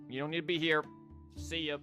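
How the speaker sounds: usual speaking voice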